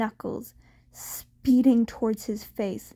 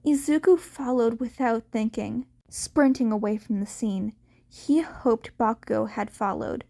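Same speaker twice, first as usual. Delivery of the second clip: ordinary delivery, nothing marked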